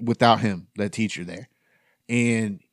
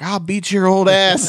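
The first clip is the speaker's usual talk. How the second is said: higher, louder, slow growled threat; mock angry threat; 9 semitones higher, 6 dB louder than usual. Fat Greg woken by the teacher, mock angry threat. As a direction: act out a groggy student snapping back.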